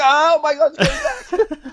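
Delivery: very high squealed mock panic; high-pitched, laughing panic replay; retold panic. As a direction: squeal it in comic alarm, breaking into laughter. Retold panic as the car returns.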